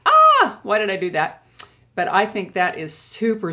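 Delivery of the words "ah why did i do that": mock horror at overdone blush; mock dismay. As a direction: cry out at your own mistake, half joking. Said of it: "I'm like".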